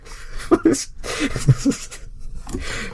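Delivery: breathless high cackle; squeaky unvoiced laugh. Real, not acted. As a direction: burst out in helpless giggles. Cracks up after reading.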